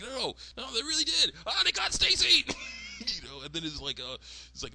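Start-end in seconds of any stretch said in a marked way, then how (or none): 0.0-2.4 high squeal building to loud panic; insist, rising into a squeaky cry; Candace. Retold or imitated. Daughter tells mom her friend is taken, high alarm. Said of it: "She's like".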